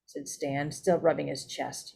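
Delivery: ordinary delivery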